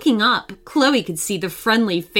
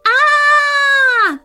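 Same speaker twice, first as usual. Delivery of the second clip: high piercing scream of terror; terrified scream; 6 dB louder than usual. A narrator's reading. Teenagers screaming at a werewolf, high terrified shriek. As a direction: shriek in fright. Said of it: "they both screamed".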